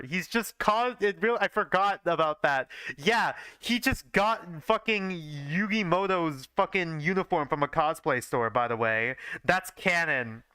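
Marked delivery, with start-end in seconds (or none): none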